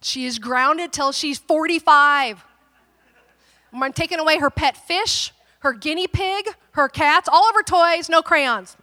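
loud, high, mounting tirade; mad, fired up. Retold or imitated. Furious mother listing punishments for daughter, fired up. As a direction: rattle off punishments with rising outrage. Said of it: "I get mad. The Sicilian part comes out".

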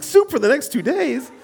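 "Soup for the next two days?" echoed high and aghast; incredulous repeat. Retold or imitated. Pastor repeating the nurse's soup rule, aghast. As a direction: throw "Soup" back in high disbelief. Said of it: "I'm like".

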